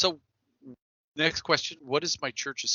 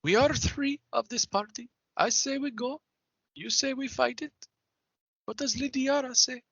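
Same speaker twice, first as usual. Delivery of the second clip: higher, formal character voice, deliberate phrases; cautious party member in character; 13 semitones higher than usual. Acted. Calling on the third companion to decide, formal in character. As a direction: put it to the party in a stately, higher voice. Player speaks as their character.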